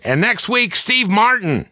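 high-pitched, excited announcement; excited yell. Retold or imitated. Writer announcing next week's host to the staff, excited yell. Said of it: "Then I'd yell excitedly".

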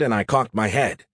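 plain reading voice

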